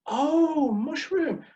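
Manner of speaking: mock delighted server finally getting it; server's sudden understanding. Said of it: "And the server said".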